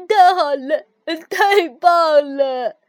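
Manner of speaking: loud, slowed, delighted cheering; joyful cheer. A narrator's reading. The frog cheers at building a snowman, loud joyful cheering. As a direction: cheer like a child told yes. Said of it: "跳跳欢呼起来".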